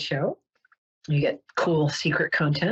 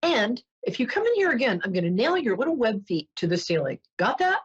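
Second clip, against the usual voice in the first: mock stern warning, rising high at the end; threatening bartender; 4 semitones higher than usual. Bartender threatening the duck, mock stern. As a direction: lay down the law, pitched up, comically. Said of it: "And the bartender says".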